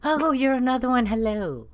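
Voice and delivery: pleasantly surprised. Acted. Player greets a fellow vampire in character.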